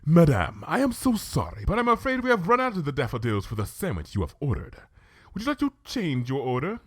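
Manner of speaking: polite apologetic waiter; a hint of a French waiter; apologetic waiter to Twilight. Waiter character voice.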